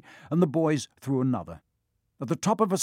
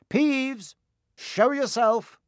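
high, commanding call, drawn out; raised voice; 12 semitones higher, 3 dB louder, much slower than usual. A narrator's reading. Percy ordering Peeves to show himself, raised voice. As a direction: call out sternly at a much higher pitch. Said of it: "He raised his voice".

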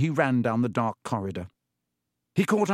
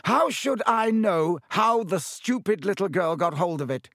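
put-on raised, scornful snap at the boy; angry retort; 7 semitones higher than usual. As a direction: sneer it down at him, higher and sharper. Malfoy snaps at Harry.

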